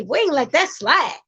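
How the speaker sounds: ordinary talk, nothing marked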